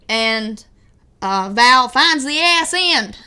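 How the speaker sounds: usual speaking voice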